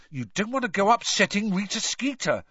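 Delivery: high-pitched, uneasy warning; nervous. A narrator's reading. Ron warning Hermione off the reporter, nervous. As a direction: caution her nervously. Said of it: "said Ron nervously".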